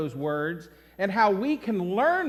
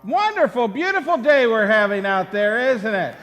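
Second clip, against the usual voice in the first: cheerful loud opener; upbeat greeting; 7 dB louder than usual. Real, not acted. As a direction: sell the nice weather.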